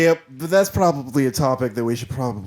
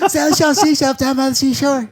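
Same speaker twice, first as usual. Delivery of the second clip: high, louder cartoon kid voice; Cartman impression; 12 semitones higher, 5 dB louder than usual. Shown as a demonstration. Singer does Cartman on request, high and loud. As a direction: do the cartoon boy bit. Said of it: "all right, Cartman".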